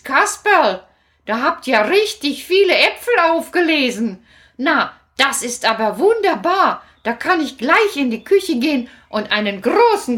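lightly voiced old grandmother, delighted; Großmutter, pleased. Grandmother praising Kasperl's apples, lightly voiced. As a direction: cheer at the basket of apples.

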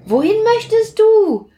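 curious question. Acted. Sprüh asks Plitsch.

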